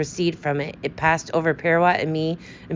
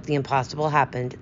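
ordinary delivery, nothing marked

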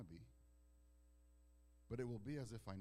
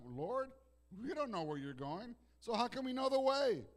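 voicing Thomas; 11 semitones higher, 9 dB louder than usual. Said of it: "what does Thomas say".

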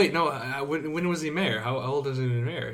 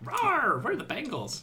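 mock tiger roar; 12 semitones higher, slower than usual. Mock Bengals mascot roar.

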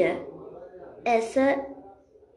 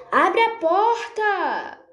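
shouting through the door; 4 semitones higher, 4 dB louder, much slower than usual. Acted.

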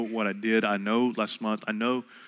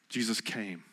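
much softer echo, almost breathed; near-whispered repeat; 3 semitones lower, 12 dB quieter than usual. Shown as a demonstration. Preacher repeating to doubters, even softer echo. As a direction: drop to a hush the second time. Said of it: "You ready?".

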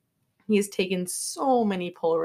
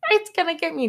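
mock fright; 10 semitones higher, 4 dB louder, faster than usual. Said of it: "Rachel's like".

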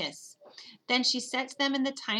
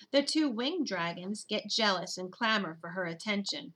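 ordinary delivery

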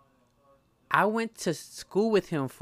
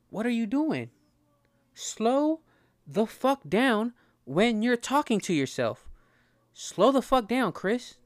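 scolding himself; 6 semitones higher than usual. Acted. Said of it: "I gotta talk to myself for a second".

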